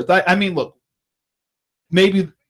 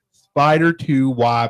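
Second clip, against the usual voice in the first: slower second try at a Gruden voice; Gruden impression retry; 3 semitones lower, much slower than usual. Host retries a Gruden impression, very slow and drawn out. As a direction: stretch it out, still not quite Gruden. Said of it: "let me try again".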